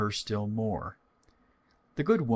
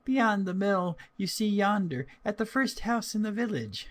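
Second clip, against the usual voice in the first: put-on higher, light little girl voice; 11 semitones higher than usual. Girl telling the wolf her errand, high child voice. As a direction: speak up like a small girl.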